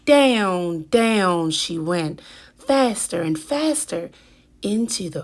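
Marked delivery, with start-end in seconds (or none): none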